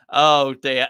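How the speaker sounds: ordinary talk, nothing marked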